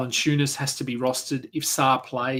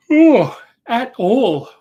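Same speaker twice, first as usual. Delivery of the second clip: words stretched through a big yawn; 11 semitones higher, 12 dB louder, much slower than usual. Real, not acted. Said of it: "Man, I'm yawning now".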